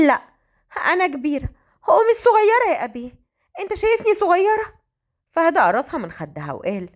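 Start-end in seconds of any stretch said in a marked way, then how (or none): 0.1-4.8 high-pitched, indignant little-sister protest; childish anger. A narrator's reading. Sondos insists to her brother she is grown, childishly cross. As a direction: pout it out in a high girlish voice. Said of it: "سندس بغضب طفولي".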